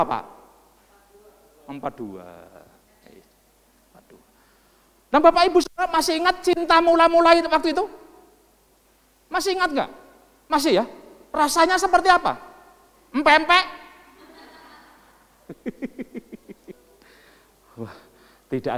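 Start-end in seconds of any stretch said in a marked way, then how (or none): 5.1-13.6 high, loud quizzing of the congregation; rousing preacher's question. Real, not acted. Preacher asking married couples about first love, loud and rousing. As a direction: throw the questions out to the whole room.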